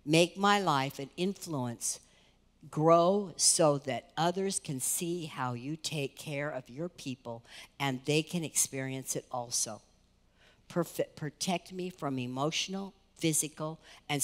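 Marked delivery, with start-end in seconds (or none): none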